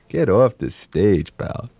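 slow, put-on dismissal; mock heckler. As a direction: draw it out like a jaded tough guy. Heckling the parodied singer in character.

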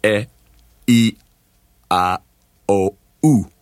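"e, I, O, u" as separate, pure Italian vowels. Shown as a demonstration. Singer demonstrating his coach's exercise, "e, I, O, u" as open Italian vowels. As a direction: sound out "e, I, O, u" one by one, with pauses between. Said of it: "using the Italian vowels".

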